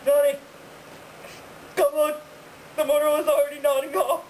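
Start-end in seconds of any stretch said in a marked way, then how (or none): 0.0-2.1 calling out. Acted. Wife in the play calls out to her husband.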